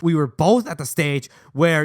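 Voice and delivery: ordinary delivery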